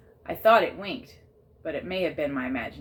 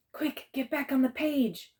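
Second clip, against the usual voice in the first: urgent; 9 semitones higher than usual. A narrator's reading. Urgent plea to the dragon.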